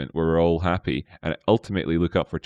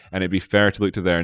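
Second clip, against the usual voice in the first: usual speaking voice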